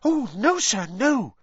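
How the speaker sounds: high, loud emphatic elf denial; serious Dobby elf. A narrator's reading. Dobby answers Harry's question, high and serious. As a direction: deny it earnestly in a squeaky voice. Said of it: "looking suddenly serious".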